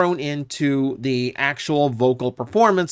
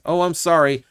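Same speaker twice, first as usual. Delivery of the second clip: normal talking voice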